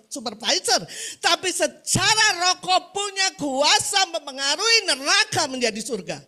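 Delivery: high, emphatic exhortation with stretched key words; preaching at full force. Real, not acted. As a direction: drive it home to the congregation.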